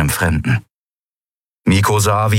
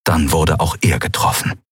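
ordinary delivery, nothing marked

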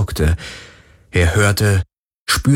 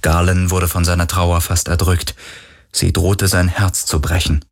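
plain reading voice

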